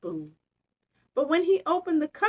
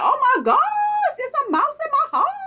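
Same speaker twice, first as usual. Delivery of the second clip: much higher, louder, fast; startled shriek; 6 dB louder, faster than usual. Acted. Startled bear spotting a mouse in his house. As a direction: shriek in mock panic. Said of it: "He's like".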